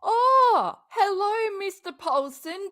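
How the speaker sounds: loud, high mock hello called across the room; booming mock greeting. Real, not acted. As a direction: call out a big sarcastic hello.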